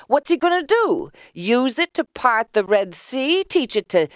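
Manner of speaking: high, mocking questions; high-pitched sceptical neighbour; incredulous woman. A narrator's reading. Leah answers in high character voice.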